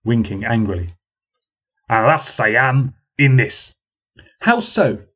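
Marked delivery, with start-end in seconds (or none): 1.9-3.7 put-on slow, weighty country accent; old man's accent. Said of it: "that distinctive accent". 4.4-5.0 put-on much higher, challenging young woman; woman challenging the old man; parrying question. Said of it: "parried the lady detractor".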